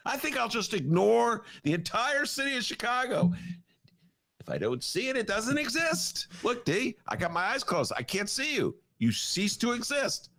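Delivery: high, playful mock voice; hammed-up governor pretending not to see. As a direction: tease like a child hiding behind closed eyes. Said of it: "So he's just, you know".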